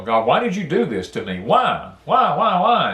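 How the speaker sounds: mock demanding why, rising each time; grieving man crying out at God, rising angrily; angry cry at God. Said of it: "I was angry with God".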